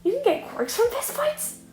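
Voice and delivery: high, slow astonished question; stunned. Acted. Shigaraki stunned by Midoriya's answer, high and slow. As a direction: let the disbelief stretch out.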